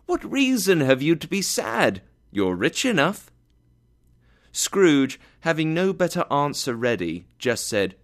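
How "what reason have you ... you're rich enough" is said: high, cheerful comeback. A narrator's reading. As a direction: tease him gaily with a high-pitched question. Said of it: "replied the nephew gaily".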